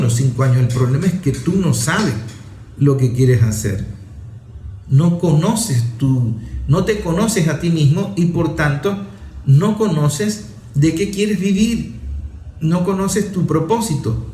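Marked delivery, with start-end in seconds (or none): none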